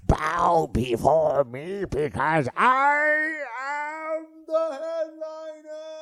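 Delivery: slow, booming hero proclamation, building to a shout; He-Man voice. Retold or imitated. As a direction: bellow it like a cartoon hero. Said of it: "He-Man".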